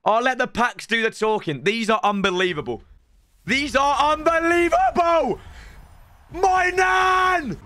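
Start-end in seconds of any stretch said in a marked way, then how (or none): 3.4-5.4 big hype shout over a great pull; excited shout. Real, not acted. Repeated, second take shouted. 6.3-7.6 shocked hype yell; excited shout. Real, not acted. Shouts at pack pull.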